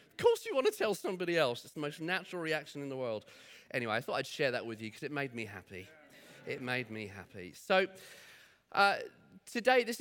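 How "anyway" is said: ordinary talk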